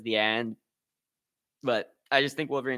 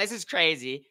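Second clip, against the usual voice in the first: usual speaking voice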